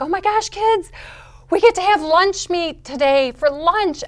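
high, loud burst of delight; excited. Retold or imitated. Mother telling kids about lunch meat, excited. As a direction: squeal the good news to the kids. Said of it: "I am excited over lunch meat".

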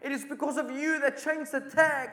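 the general sobs out his grief at the nurse's feet; anguished, high, tearful outcry; weeping. Retold or imitated. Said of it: "starts weeping".

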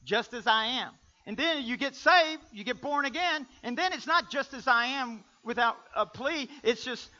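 high, loud, driving preacher's delivery; full-force preaching. Real, not acted. Preacher contrasting the hymn with church practice, full-force preaching. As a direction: press it hard on the congregation.

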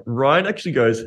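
normal talking voice

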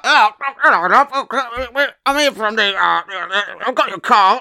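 high, garbled silly puppet-like voice; comic caller voice. Acted. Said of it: "I'm doing the voice".

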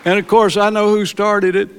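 loud, high proclaiming burst; emphatic declaration. Real, not acted. Preacher tells church the Lord restarted his heart, loud proclamation. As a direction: proclaim it to the congregation.

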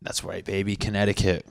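normal talking voice

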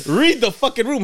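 loud, very high comic outburst; exasperated shout. Retold or imitated. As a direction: yell it at a clueless son, half laughing. Exasperated dad repeats it, escalating.

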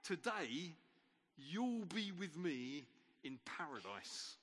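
slow, gentle promise to a dying man; a calm, unhurried reassurance; reassuring promise. Retold or imitated. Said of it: "Mary would have heard him saying".

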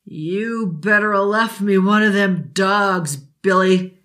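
slow, rough menacing father; surly drunk father. Acted. Drunk father snarling at Billy in a foul mood. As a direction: growl it like a mean drunk. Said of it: "The man was in a foul mood".

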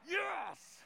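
high, loud burst of joy; exultant, over-the-top cheer; mock triumphant shout. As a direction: shout it like a huge win. Retold joy at friend's reply.

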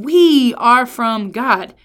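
"We" slow, loud and stretched out; emphasis on We. Shown as a demonstration. Preacher closes John's sandwich, stress on We. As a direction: draw out a forceful "We". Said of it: "the emphasis that I think".

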